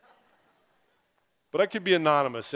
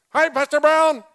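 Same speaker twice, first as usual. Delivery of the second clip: much higher, booming greeting across the whole store; loud and obnoxious; 14 semitones higher, 9 dB louder than usual. Retold or imitated. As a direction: yell the greeting so everyone hears. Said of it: "I mean, loud and obnoxious".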